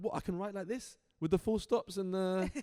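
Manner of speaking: incredulous. Retold or imitated. Writer asking agent if the odd style is allowed, incredulous. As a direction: act out amazed double-checking, voice shooting up. Retells disbelief at agent's approval.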